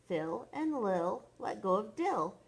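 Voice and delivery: ordinary delivery, nothing marked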